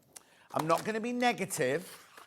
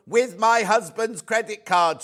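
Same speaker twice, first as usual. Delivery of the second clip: louder, slow mock shout; 4 semitones higher, 7 dB louder than usual. Reading a viewer's joke, mock shouting. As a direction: shout it out slowly, playfully. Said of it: "she shouts".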